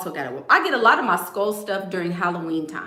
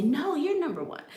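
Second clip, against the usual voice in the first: lightly imitated dad, pitched up; voicing her daddy; 8 semitones higher, 3 dB quieter than usual. Daddy arguing back to his daughter, lightly imitated high voice. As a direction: hand the argument back as the dad. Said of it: "And he'd say".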